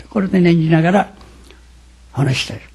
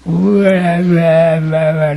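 imitating loud wailing. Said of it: "ばあちゃんは派手なの".

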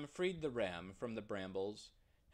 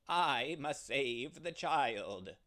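louder, slow, steady; ram's resolve; 3 semitones higher, 5 dB louder, slower than usual. Acted. The ram answers the lion, loud, slow and steady. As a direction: hold firm against the lion. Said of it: "the ram replied".

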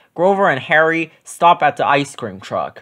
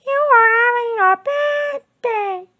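high, sing-song Miss Ticky. A narrator's reading. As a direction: call him like a chirpy teacher. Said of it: "said Miss Ticky".

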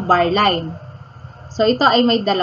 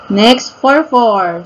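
ordinary talk

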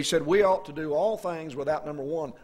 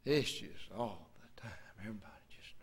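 whisper; 8 semitones lower, 13 dB quieter than usual. Retold or imitated. Gossip at nursing home.

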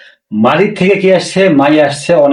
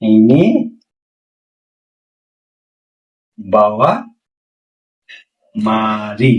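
slow dictation; 6 semitones lower, much slower than usual. Shown as a demonstration. Teacher dictating the Malay phrase slowly word by word. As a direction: spell it out slowly for learners. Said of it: "বাওয়া মানে হলো".

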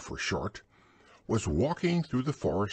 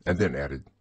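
usual speaking voice

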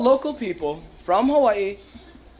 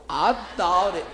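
mainland phrase; 3 semitones lower than usual. Shown as a demonstration. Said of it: "say it with me".

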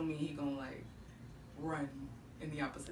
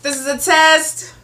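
loud announcing; 6 semitones higher, 14 dB louder than usual. Real, not acted. Mic check announcement.

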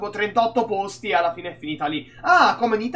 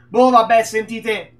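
ordinary delivery

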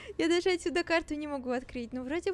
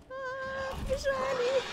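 panicked shout; 10 semitones higher, slower than usual. Real, not acted. Fleeing monster.

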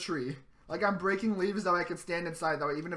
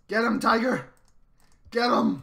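higher, loud urging yell; excited shout; 6 semitones higher, 8 dB louder, slower than usual. Real, not acted. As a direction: yell encouragement at a teammate. Cheering teammate on in fight.

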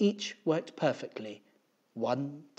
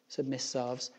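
ordinary delivery

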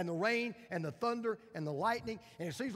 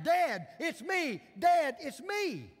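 put-on much higher, slow, a small boy's voice from under rocks; boy calling back; 11 semitones higher, 9 dB louder, much slower than usual. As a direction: answer slowly and high like a trapped child. Boy answering from the rubble.

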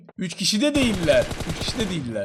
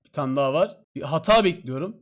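ordinary delivery

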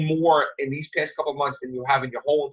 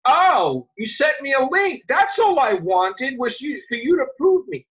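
a touch of mocking the fans who asked; mock critics; 8 semitones higher, slower than usual. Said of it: "all these people are like".

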